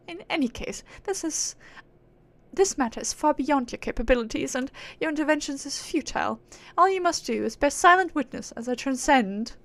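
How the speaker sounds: dismissive aristocratic British; lofty British accent, waving off lesser beings. Acted. Proud being dismissing mortals' help, haughty British accent. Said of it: "she's acting all high and mighty".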